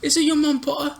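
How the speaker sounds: "Is it your mom, Potter?" said high and soft, sneering; high mock taunt. Friend redoes the taunt as coached, high and soft. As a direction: squeak out the taunt. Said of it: "You gotta say it with the W".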